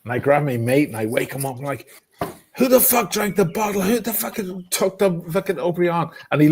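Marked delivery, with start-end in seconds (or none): 2.6-6.1 high, outraged demand; mock outraged demand. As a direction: demand it high and indignant, like you've been robbed. Demanding who drank bottle.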